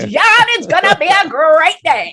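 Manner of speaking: high, loud clipped wartime posh announcer; brisk old newsreel voice; 1940s announcer. Acted. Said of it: "those nineteen forties announcer kind".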